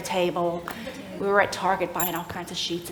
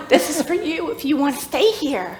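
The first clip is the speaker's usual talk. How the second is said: laughing offer; 8 semitones higher than usual. Real, not acted. Offering the guest room to Linda, laughing through it. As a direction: voice rising high with laughter as she offers.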